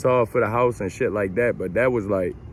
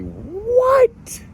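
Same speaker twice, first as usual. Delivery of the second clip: shrieked high what; sudden high squeak of shock; stunned what; 3 dB louder than usual. Retold or imitated. Hearing the account is negative, a high shocked squeak.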